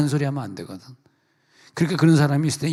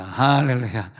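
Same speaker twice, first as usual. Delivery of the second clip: ordinary talk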